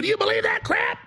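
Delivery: retold shout of disbelief; incredulous shout. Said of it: "I go".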